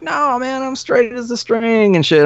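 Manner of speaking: put-on high, slurry drunk voice insisting he's fine; drunk denial. Drunk bandmate insisting he's sober.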